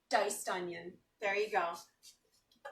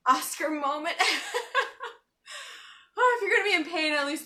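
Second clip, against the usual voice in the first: laughs through the words, louder; real giggle while teary from onions; laughing at her onion tears; 5 semitones higher, 6 dB louder, faster than usual. Real, not acted.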